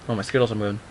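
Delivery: ordinary delivery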